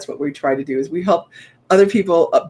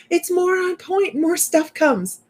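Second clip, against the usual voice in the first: excited; 11 semitones higher, slower than usual. Acted. Upbeat emphatic promise.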